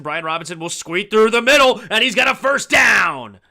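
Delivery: loud shout climbing in pitch, stretched at the end; excited play-by-play. Real, not acted. Announcer calling a first down to viewers, excited shouted play-by-play. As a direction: belt out the big run as it breaks through. Calls a first-down run live.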